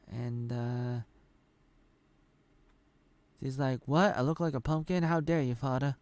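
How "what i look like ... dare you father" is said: higher-pitched acted outrage; mock indignation. Daughter challenges her father over looking like a pumpkin, mock indignant. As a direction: imitate an affronted daughter, pitched up.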